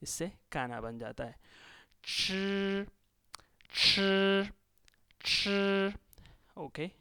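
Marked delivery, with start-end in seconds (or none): 2.0-5.9 "吃" held long, high and level; aspirated, flat-toned "吃" drill; first tone. Shown as a demonstration. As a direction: sustain "ची" and "吃" on one high pitch. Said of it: "पहले tone के साथ".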